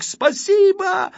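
loud, high, drawn-out thanks; joyful exclamation. A narrator's reading. Photographer thanks the diver for his camera, jubilant. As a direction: call out thanks in a high delighted cry. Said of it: "воскликнул объективчик".

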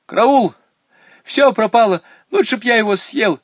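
high, loud cry of alarm; panicked shout. Acted. Шоколад пропал из кармана. (громкий испуганный крик)

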